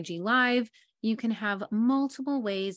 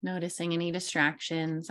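guided meditation. Acted. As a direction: ease listeners into their chairs, unhurried. Leads a grounding meditation.

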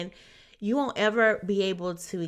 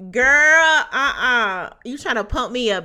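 loud, laughing, playful no-way; skeptical sass; 5 semitones higher, 11 dB louder than usual. Retold or imitated. Friend scoffing at being pumped up, loud playful disbelief. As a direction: shut down the hype with a grin.

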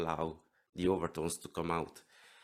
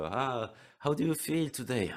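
playful character voice; 6 semitones higher than usual. Shown as a demonstration. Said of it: "you can split your personality".

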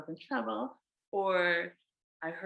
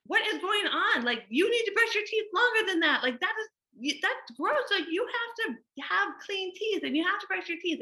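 much higher, louder, harsh scold; disgusted mom berating a child; exasperated scolding; 12 semitones higher, 3 dB louder than usual. Retold or imitated. Mom scolding Luna for brushing teeth too briefly, exasperated high. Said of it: "still being like".

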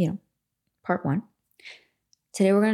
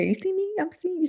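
high plea; 13 semitones higher, faster than usual. Acted. Pleading for recognition.

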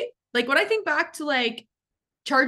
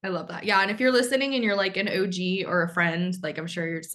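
normal talking voice

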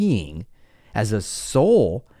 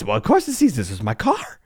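high, ranting mock outrage; indignant car owner; 7 semitones higher than usual. Adult ranting about his keyed Mercedes, high mock outrage. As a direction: rant like a status-obsessed adult. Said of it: "He went".

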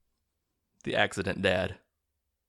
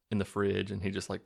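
ordinary delivery

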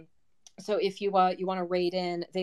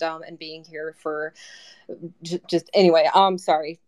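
holding back sobs; 2 semitones lower, 7 dB louder than usual. Real, not acted. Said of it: "I get emotional".

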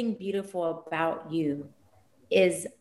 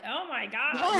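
mock delighted gasp; 6 semitones higher, slower than usual. Retold moment of joy with clients.

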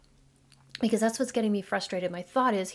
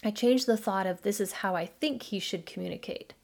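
ordinary talk

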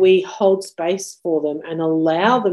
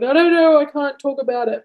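put-on distressed child; 7 semitones higher than usual. Son crying, can't verbalize.

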